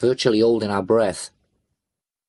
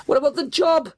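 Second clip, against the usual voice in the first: high-pitched desperate yell; screaming. A narrator's reading. Driver trying to restore normality, screaming. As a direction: scream it to drag them back to the plan. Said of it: "I screamed".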